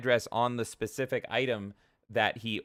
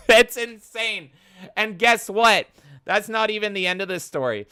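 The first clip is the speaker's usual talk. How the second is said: excited; 9 semitones higher, 9 dB louder than usual. Real, not acted. Excited rant.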